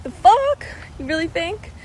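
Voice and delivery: high-pitched, rising incredulous question; squeaked retold double take; startled disbelief. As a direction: shoot way up in pitch, as if taken aback. Retold startled reply to mom's ER suggestion.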